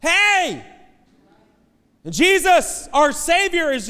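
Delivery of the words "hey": high, loud call for attention; a father crying out for help. Retold or imitated. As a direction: shout "Hey!" to get noticed. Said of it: "I've been Jairus, like".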